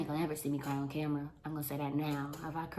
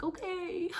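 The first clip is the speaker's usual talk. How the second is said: high put-on voice; mock okay. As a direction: chirp it in a mock voice.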